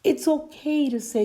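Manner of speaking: normal talking voice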